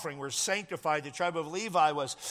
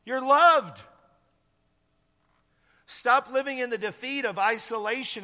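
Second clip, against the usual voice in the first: loud, higher, ringing declaration; emphatic proclamation; 5 semitones higher, 5 dB louder than usual. Real, not acted. Pastor to congregation, loud ringing proclamation. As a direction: proclaim it over the whole room.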